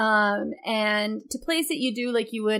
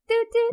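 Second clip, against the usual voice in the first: high-pitched toot toot; horn imitation; 12 semitones higher than usual. Co-host explaining she tooted her horn, high toots.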